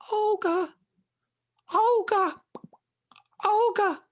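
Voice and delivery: high gurgling fish calling out; gurgly high-pitched call. Acted. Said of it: "she heard a gurgly voice calling".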